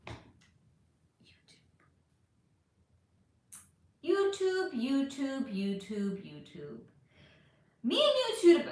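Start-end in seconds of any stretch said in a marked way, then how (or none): 0.1-1.6 whispering. Pretend. Calling YouTube's name, whispered. 4.0-6.8 sing-song stepping down. Pretend. Repeated name, each lower.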